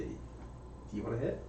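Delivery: tiny character offering Rick a hit; sly whispered offer. Acted. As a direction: lean in and murmur the offer. Small character offers smoke.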